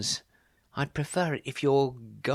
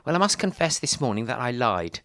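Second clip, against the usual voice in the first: ordinary talk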